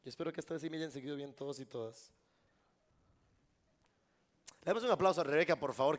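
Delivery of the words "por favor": usual speaking voice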